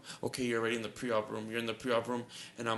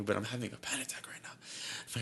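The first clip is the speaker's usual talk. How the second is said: a secretive whisper; admitting a panic attack to the nurse in a whisper; whispered confession; 7 dB quieter than usual. Retold or imitated.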